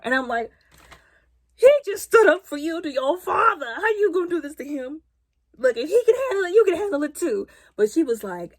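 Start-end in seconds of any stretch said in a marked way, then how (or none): 1.6-7.5 high, loud disbelief at the screen; raised incredulous plea; exasperated pleading. Real, not acted. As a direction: plead with her, half laughing. Pleading with character.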